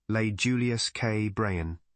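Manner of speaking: usual speaking voice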